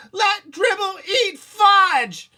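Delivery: very high, loud, slow crying shout; scream. Acted. Boy screaming at mom about the turtle, crying. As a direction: scream it through tears. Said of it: "I screamed".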